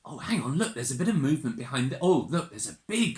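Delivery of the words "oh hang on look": ordinary delivery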